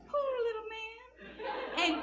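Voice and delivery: put-on high, slow, tiny child's voice; cute little girl voice. Mimicking the little girl in the elevator, high and slow. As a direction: coo it like a sweet five-year-old. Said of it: "high-pitched".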